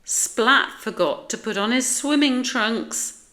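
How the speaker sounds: high, loud taunt; mocking schoolyard jeer, pitched high; teasing. A narrator's reading. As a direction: tease so everybody hears. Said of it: "teased Spike".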